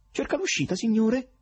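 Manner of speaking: high, cracked tenor, ingratiating. Acted. Checkered fellow accosting Berlioz at the exit, cracked tenor. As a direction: wheedle like a shabby clown. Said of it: "con fessa voce tenorile".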